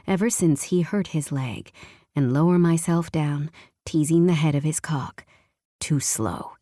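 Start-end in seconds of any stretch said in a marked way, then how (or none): 5.8-6.5 deep, rough husband's growl, pitched well down; low growl. A narrator's reading. Husband growling at his wife in bed, low impatient growl. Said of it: "he growls".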